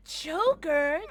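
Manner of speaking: loud, high sing-out of a name; delighted call. Real, not acted. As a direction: greet someone dear with a loud, high call. Calling out to Joker.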